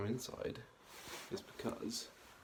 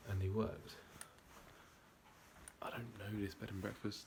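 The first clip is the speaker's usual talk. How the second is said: hushed whisper so nobody wakes; 8 dB quieter than usual. Real, not acted. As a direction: whisper quietly, late at night. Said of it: "which is why I'm whispering".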